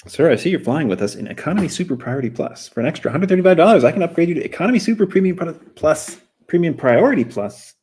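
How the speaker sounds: high, fast, over-eager sales pitch voice; pushy flight attendant. Acted. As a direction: upsell him like a chirpy cabin crew member. Reading airline upsell in comic.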